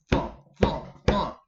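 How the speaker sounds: loud breathy thump thump thump; loud footsteps. Acted. Storyteller sounds the monster approaching, heavy thumping. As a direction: stomp out the giant's footsteps.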